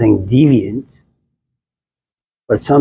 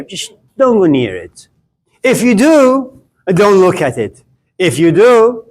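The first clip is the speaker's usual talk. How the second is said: much higher, building chain of if-you-do warnings; escalating commandments; 8 semitones higher than usual. Acted. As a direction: climb in pitch with every rule, like a mock-stern god. God's escalating rules about the tree.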